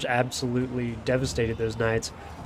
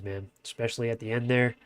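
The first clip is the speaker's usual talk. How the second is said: ordinary delivery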